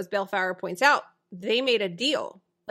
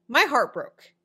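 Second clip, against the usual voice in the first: normal talking voice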